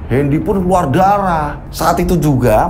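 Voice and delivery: ordinary delivery, nothing marked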